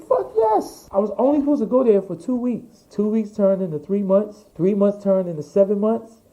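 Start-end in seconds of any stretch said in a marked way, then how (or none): 0.1-0.9 very high, gleeful squeal; retold elated yes. Designer accepting an offer to collaborate, ecstatic. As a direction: jump at the offer, voice leaping up.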